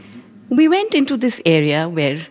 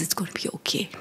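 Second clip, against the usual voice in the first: very soft, hushed comforting voice; gently quoted mother's comfort, much softer; soothing reassurance; 12 dB quieter, faster than usual. Retold or imitated. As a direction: reassure a crying child, barely above a murmur. Mother comforting her crying child.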